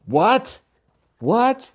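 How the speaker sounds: can't believe what he just read; stunned disbelief. Real, not acted. Stunned by Dumbledore's words.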